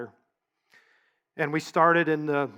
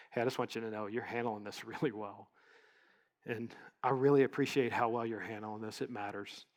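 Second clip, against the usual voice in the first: gentle thanks; 5 semitones lower, 10 dB quieter than usual. Retold or imitated. Kindly thanking airline agent.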